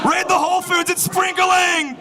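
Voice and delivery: very high hysterical shout; mock freak-out. Locals losing their minds over rain, high drawn-out panicked shout. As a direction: sound the alarm, stretching the last word. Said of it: "People just freak out".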